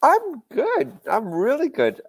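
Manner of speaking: usual speaking voice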